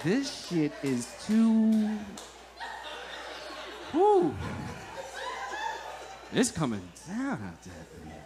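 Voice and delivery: slow, dazed stoner drawl with a laugh; stoned amazement. Retold or imitated. Stoned in car during rain.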